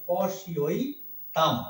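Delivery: পশিঐতাম said very slowly as po-shi-oi-tam; careful pronunciation. Shown as a demonstration. Teacher showing how পশ্যৈতাম is read, slow syllables. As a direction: separate each sound of পশিঐতাম. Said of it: "এইটা হচ্ছে শুদ্ধ উচ্চারণ".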